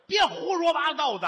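loud, high scolding; angry grandmother. Acted. Grandmother scolds her sulking daughter, loud and high. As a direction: snap at the daughter to stop the nonsense. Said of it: "姥姥也生气".

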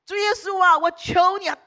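loud, high, pleading prayer cry. Retold or imitated. Wife lays hands on him, crying out loud in prayer. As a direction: cry out to Jesus with all your voice. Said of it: "大声的呼喊说".

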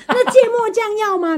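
high, chirpy mock of a grinning vendor asking; sunny vendor. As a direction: imitate the vendor's bright, bouncy question. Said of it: "然后他还说". (模仿老板高亢开朗地问)

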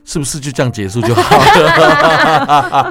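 loud, slowed, high-pitched plea through laughter; mock inner cry. Actor retelling the cast's silent wish to radio host, mock desperate cry. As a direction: cry out your secret wish, half laughing. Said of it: "那个默默的呐喊说".

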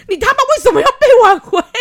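high, loud, fast shout breaking into laughter; outraged laughing cry; exasperated shout. Real, not acted. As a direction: yell it in disbelief. Exasperated at the reconciliation.

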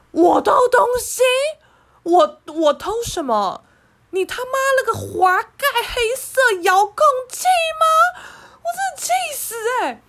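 high, loud furious yelling, voice cracking up; outraged shout. Retold or imitated. Accused of stealing a remote, shouting in outrage. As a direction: explode with indignation at the accusation. Outraged at being called thief.

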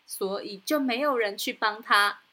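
plain reading voice

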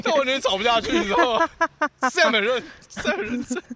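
high, loud, words through laughter; can barely speak for laughing; laughing while talking. Real, not acted. Still laughing after the story.

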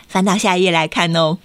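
plain reading voice

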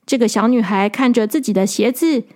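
plain reading voice

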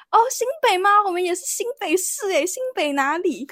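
lightly voiced old man, high and eager; a hint of a curious uncle's surprise. Old man asking where they're from, lightly voiced eager tone.

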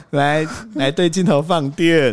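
drawn-out playful prompt; slow teasing. Retold or imitated. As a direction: coax it slowly, teasing. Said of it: "是说".

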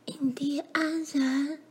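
slow, high, hushed whisper; frightened whisper. A narrator's reading. Mary warns her sibling of two approaching strangers, whispering. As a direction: whisper it slowly, barely daring to speak. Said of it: "玛丽小声地说".

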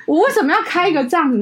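high-pitched exasperated complaint; exasperated outburst. Real, not acted. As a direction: throw up your hands, disbelieving. (拔高音调的崩溃抱怨)

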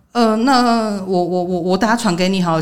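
drawn-out, hesitant retold stutter; stalling stammer. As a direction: imitate someone stalling over each word. 模仿对方心虚拖延. (模仿支支吾吾地拖延)

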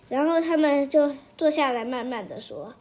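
plain reading voice

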